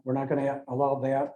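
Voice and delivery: ordinary delivery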